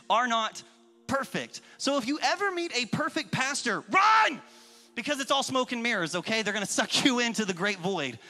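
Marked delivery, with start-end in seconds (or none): 3.9-4.4 high, explosive comic yell; mock warning shout. Pastor warning people off perfect pastors, mock panicked shout. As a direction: shout it like a joking alarm.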